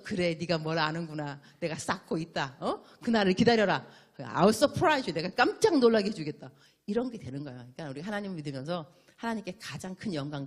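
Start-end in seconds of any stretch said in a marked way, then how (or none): 0.0-6.5 a loud, pleased promise; pleased Lord. Retold or imitated. Preacher voicing the Lord rewarding a grateful child, loud and pleased. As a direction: promise a surprise with pleased authority. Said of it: "이래야 주님께서도".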